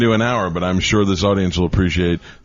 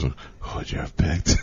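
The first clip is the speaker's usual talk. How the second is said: weak whisper; 5 semitones lower, 8 dB quieter than usual. Retold or imitated. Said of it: "He goes".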